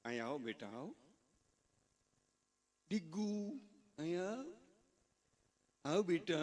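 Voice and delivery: a hint of a sweet mother coaxing; mother calling softly. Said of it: "એની મા બરકે કિચનમાંથી". (રસોડામાંથી બાળકને બોલાવતી માં, ધીમા અવાજે)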